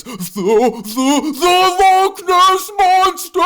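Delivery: high, slow, terrified stutter; stammering fear. Acted. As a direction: stammer it out in panic. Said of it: "becoming full of fear".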